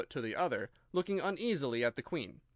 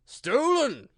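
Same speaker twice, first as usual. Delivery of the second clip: much higher, loud outraged cry; loud high exclamation; exclaiming king; 11 semitones higher, 10 dB louder than usual. A narrator's reading. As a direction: burst out in royal outrage. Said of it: "the king exclaimed".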